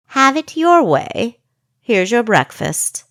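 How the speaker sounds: mildly mocking jailer; captor voice. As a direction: toss it off like a bored guard. Second captor in story.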